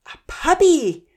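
high, loud, drawn-out cry of delight; boy spotting a puppy, eager shout; eager call. A narrator's reading. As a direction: call out, thrilled to see a puppy. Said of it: "Zahir called out eagerly".

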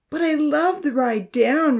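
high, slow whiny moan. A narrator's reading. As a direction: complain that the fun part is worth it. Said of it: "Rosa moaned".

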